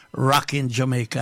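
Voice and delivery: ordinary talk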